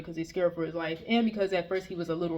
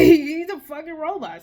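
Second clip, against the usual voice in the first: higher, louder, slower, laughing; bursting out with a disbelieving laugh; louder laughing repeat; 7 semitones higher, 8 dB louder than usual. Real, not acted.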